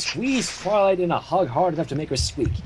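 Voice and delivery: usual speaking voice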